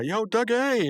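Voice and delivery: high excited call across the yard. Retold or imitated. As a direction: shout a greeting to a friend. Mordecai calling out to Doug.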